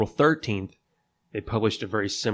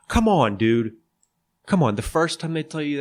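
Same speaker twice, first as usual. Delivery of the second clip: exasperated; 6 semitones higher, 4 dB louder than usual. Real, not acted. Exasperated at Morris's behavior.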